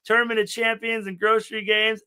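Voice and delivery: high-pitched, crying-style comforting; retold tearful reassurance. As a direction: promise nice things through imitated tears. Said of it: "I'm like, I'm crying".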